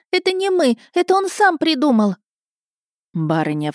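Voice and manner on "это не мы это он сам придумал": put-on high-pitched childish self-defence; put-on squeaky lad; squealing boy. A narrator's reading. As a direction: protest like a panicked kid. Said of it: "заверещал парнишка в картузе".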